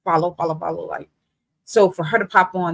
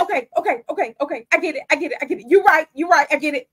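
high, mock yelled okay okay; imitating a scream; 9 semitones higher, faster than usual. Homeless man backing off, retold as a high mock yell. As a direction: retell his frantic shouting, pitched up. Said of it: "this man screaming to the top of his lungs".

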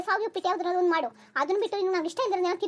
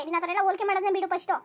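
ordinary talk

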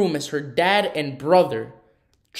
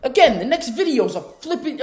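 disgusted disbelief; 10 semitones higher, 6 dB louder than usual. Real, not acted. Reacting to a disturbing clip.

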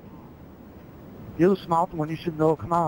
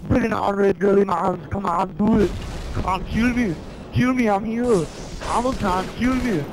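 pumped mock Austrian taunt, a bit higher; Arnold impression; 4 semitones higher than usual. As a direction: taunt the enemy like Schwarzenegger. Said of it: "talk in the Arnold voice".

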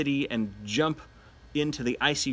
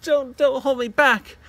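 high, loud mock pleading; hammed-up frantic plea to be let go; desperate Nora; 10 semitones higher, 6 dB louder than usual. Teacher voicing Nora begging Torvald to release her, hammed-up high plea. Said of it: "Nora's saying".

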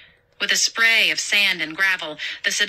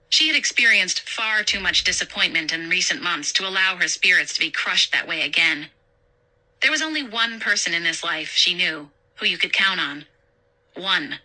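usual speaking voice

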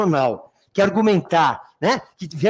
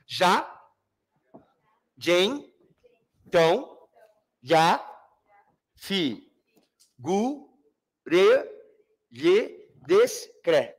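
"Já. Gen. Então." counted out one syllable at a time; long pauses between each syllable up to "Descre"; syllable-by-syllable scansion; much slower than usual. Shown as a demonstration. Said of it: "Conta até a última sílaba tônica".

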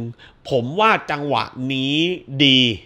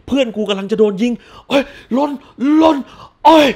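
loud, higher panicked yell; yelling in mock panic for a friend; hammed-up frantic shout; 6 semitones higher, 5 dB louder than usual. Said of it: "สมมุติกูลนเลย".

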